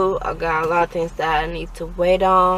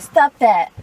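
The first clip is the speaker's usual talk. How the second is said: scolding; 5 semitones higher, 8 dB louder than usual. Real, not acted. Mother snaps at her child.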